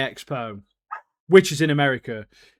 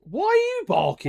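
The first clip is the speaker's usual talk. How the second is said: high pet-talk question; 12 semitones higher than usual. Real, not acted. Host asking barking dog why, high pet-talk. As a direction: ask the dog in a raised pitch.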